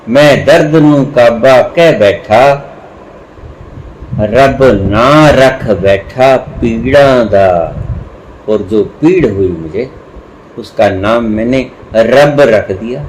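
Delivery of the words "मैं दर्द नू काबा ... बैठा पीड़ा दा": slow, drawn-out Punjabi verse; measured verse reading with long held words; poetry recitation. Acted. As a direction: recite the couplet slowly, stretching the vowels. Recites Punjabi verse, then explains it.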